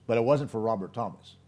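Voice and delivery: ordinary delivery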